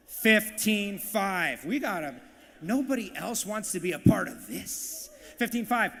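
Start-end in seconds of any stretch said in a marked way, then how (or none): 0.1-1.6 very slow, loud, stretched-out call; auctioneer's bid call. Real, not acted. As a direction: draw out each syllable for the room. Auctioneer calls out the raised bid.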